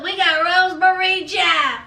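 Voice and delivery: usual speaking voice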